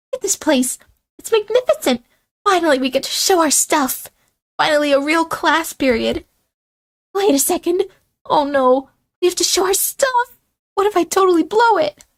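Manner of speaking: high, bright schoolgirl rising into panic; gush, then suddenly worry; excited girly voice. Acted.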